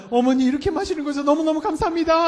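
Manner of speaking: high, fast, loud sugary thanks; grateful child voice. Retold or imitated. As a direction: play a child gushing thanks to mom. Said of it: "하면서 받아 먹을 수 있게". (높고 빠르게 감사하는 아이 흉내)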